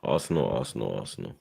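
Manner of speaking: low, fading mutter of despair; dejected muttering. Real, not acted. Fan lamenting the club after a bad game, dejected.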